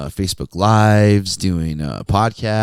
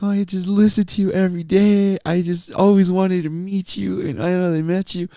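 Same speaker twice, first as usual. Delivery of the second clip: much higher, overwhelmed fan voice; imitated tearful fan; 12 semitones higher than usual. Crying teenager meets her radio idol, imitated tearful gushing. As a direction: gush like a starstruck teen in tears. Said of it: "She's all crying. She's just, like, freaking out".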